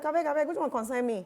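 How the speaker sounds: high, fast Pidgin retort; Nigerian Pidgin. Retold or imitated. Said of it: "some people like".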